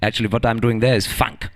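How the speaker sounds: lecturing in a put-on German accent; mock German professor; German-accented lecture; fake German accent. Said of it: "I am not in fact German".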